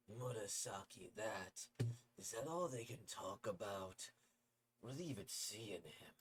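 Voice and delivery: plain reading voice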